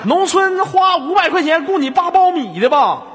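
high, loud accusing question; mock-indignant jeer. Host mocking caller's hand-work job, loud mock indignation. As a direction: heckle with hammed-up exasperation.

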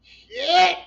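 high, loud "Shit"; retold shocked curse. Hearing the sister name the film, a loud stunned curse. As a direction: blurt it out, stunned. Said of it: "and I was like".

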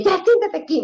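high-pitched, exasperated order; imitated scolding mother. Mother ordering her son to sit, retold scolding.

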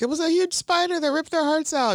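mock terrified girl; panicked girl. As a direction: squeak it out like a scared girl. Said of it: "The girl's like".

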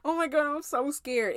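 high-pitched, lightly acted panic; mock scared. Teacher mimicking the wrong idea of fearing the Lord, mock scared. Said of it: "The fear of the Lord is not".

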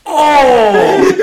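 high imitated "oh" of shock; shocked group reaction. Teammates watching the nut shot, imitated shocked cry. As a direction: cry out like wincing onlookers. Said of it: "we both just go".

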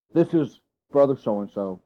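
low, slow preacher introducing a guest; ponderous, low guest introduction laying on the praise; mock honorific intro, low and drawn out; buttering-up introduction. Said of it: "just butter the guy up real good".

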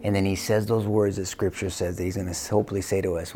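ordinary talk

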